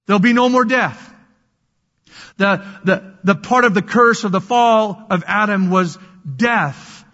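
ordinary delivery

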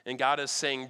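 usual speaking voice

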